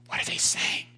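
hushed whisper. Retold or imitated. Will asks Ravi among angry captors, whispering. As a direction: whisper so the guards won't hear.

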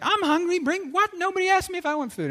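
high-pitched mock indignation; kinda mad. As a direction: whine it like someone comically put out. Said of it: "he was kinda mad about that".

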